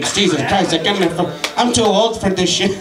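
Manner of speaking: ordinary talk, nothing marked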